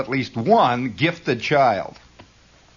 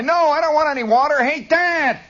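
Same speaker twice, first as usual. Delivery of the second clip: put-on high-pitched kid calling Dad; hollering kid; 14 semitones higher, 4 dB louder than usual. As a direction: holler back downstairs, high. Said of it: "and holler".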